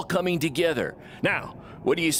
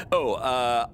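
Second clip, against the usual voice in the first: normal talking voice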